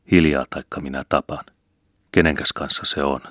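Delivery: ordinary delivery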